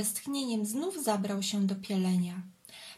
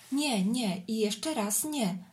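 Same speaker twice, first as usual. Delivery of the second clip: ordinary delivery, nothing marked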